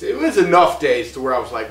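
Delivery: ordinary delivery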